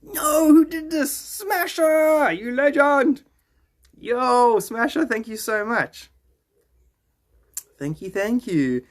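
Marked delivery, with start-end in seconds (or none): 0.0-5.1 high, loud, delighted cry; excited. Real, not acted. Streamer thanking a gifter, shrieking with delight. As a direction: burst out in thrilled disbelief. Thrilled reaction to a TikTok gift.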